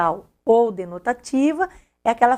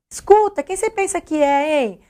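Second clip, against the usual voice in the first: demo indignant challenge; 5 semitones higher than usual. Shown as a demonstration. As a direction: snap it out like a confrontation. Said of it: "Vocês estão percebendo esse tipo de entonação".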